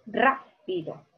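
"rápido" with RA stressed, then split from pido; stress on first syllable. Shown as a demonstration. Teacher offering the correct "rápido", first-syllable stress. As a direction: hit RA in "rápido", then pause. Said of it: "Yo digo rápido".

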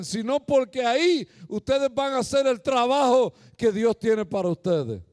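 loud, high, impassioned preaching; full-force preaching. Acted. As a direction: preach it to the crowd with fire. (predicación fuerte y apasionada)

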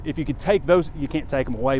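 ordinary delivery

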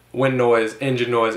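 usual speaking voice

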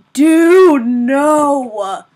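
loud, drawn-out protest; dismayed outburst. Real, not acted. Gamer protests a disaster in the game, loudly dismayed. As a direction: cry out in dismay, then drop in pitch.

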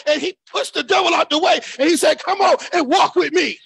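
shouted, rising sermon delivery; fiery preaching. Real, not acted. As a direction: preach it hard to the church. Preaching at full force.

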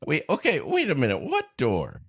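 normal talking voice

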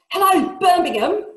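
loud, high Brummie compere greeting the crowd; broad Birmingham accent. Acted. Nightclub owner greets the Birmingham crowd, broad Brummie accent. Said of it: "he announces in a broad local accent".

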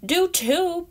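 put-on high, loud childish yell; a chipmunk kid yelling back in a spat. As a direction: fire it straight back. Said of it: "Peanut yelled back".

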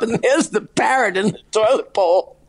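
ordinary talk, nothing marked

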